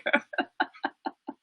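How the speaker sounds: helpless, trailing laughter; laughing. Real, not acted. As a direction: laugh till the voice gives out. Cannot stop laughing.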